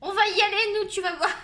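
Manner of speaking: loud, high mock threat; imitating combative friends. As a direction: play friends spoiling for a fight. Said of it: "J'en suis sûre, elles vont me dire". (imiter des copines remontées, fort et aigu)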